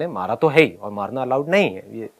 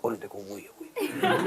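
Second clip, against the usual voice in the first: much softer, whispered gossip; gossipy whisper; 6 semitones lower, 14 dB quieter than usual. Retold or imitated. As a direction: whisper it like schoolkids pointing. School में लोग फुसफुसाते हुए. (फुसफुसाती चुगली)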